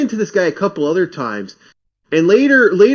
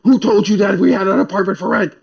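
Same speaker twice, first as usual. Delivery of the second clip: mild mock yell, raised pitch; retold screaming; 4 semitones higher than usual. Landlady's son challenges apartment hunter, mock yelling. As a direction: imitate an angry man demanding answers. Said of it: "He starts screaming at me".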